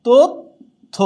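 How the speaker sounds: তথ্য said as "তোত্থো", with a doubled থ; model pronunciation. Shown as a demonstration. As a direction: tap out তথ্য with the থ held double. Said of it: "তথ্য হয়ে যাচ্ছে".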